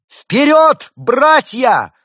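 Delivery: very high, loud rallying shout; battle cry. A narrator's reading. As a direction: yell the charge to your brothers. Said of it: "Суслик испустил боевой клич".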